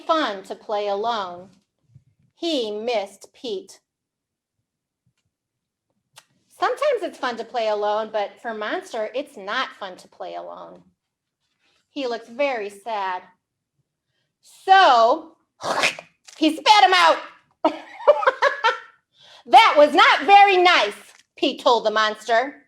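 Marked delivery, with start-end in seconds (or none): 2.3-13.2 mild sadness. Acted. Storyteller softening for the lonely monster. 19.5-20.9 louder, a touch higher rebuke; lightly voiced scolding Pete. Pete telling the monster off for eating him.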